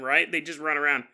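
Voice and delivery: ordinary delivery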